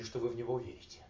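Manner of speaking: ordinary delivery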